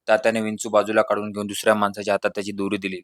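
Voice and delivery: ordinary delivery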